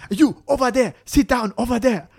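high, mock-commanding African accent. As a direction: boss him about in a put-on African accent. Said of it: "happen to be African".

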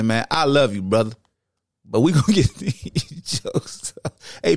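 ordinary talk

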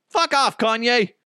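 high, dismissive retort; mock exasperation. Public telling the rapper off, high mock exasperation. Crowd dismisses the boasting star.

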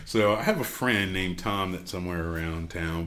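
ordinary talk

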